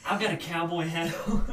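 laughing through the words. Real, not acted. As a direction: laugh it out while talking. Laughing while telling.

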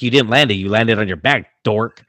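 loud, emphatic comeback; mock exasperated retort. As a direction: argue back loudly, teasing. Retold argument with athlete.

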